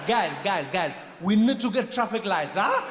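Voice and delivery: rally the guys eagerly; African guy voice. Retold or imitated. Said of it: "the guy was just like".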